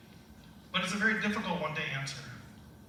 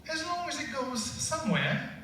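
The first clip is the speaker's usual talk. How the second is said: British Alice; 6 semitones higher than usual. Retold or imitated. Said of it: "they wanted Alice to be British".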